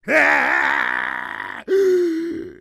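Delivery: high-pitched villainous laugh; long, gloating mad-scientist cackle; evil cackle. Acted. Villain gloats over taking the alien network, cackling.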